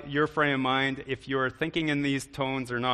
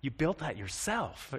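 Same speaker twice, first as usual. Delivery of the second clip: flat, unimpressed sarcasm, softer; sarcastic tone; 5 dB quieter, slower than usual. Shown as a demonstration. Preacher mocking a DIY job, sarcastic. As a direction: drip with dry sarcasm. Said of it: "that's a whole other tone".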